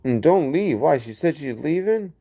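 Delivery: surprised, high rising question; real dismay. Real, not acted. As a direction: plead with them to stay, pitch jumping up into a question. Reacts to a viewer leaving.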